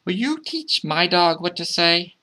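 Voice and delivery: put-on high child's voice asking for help; high, singsong child's request; child calling out. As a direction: ask sweetly in a high kid's voice. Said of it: "the child called out".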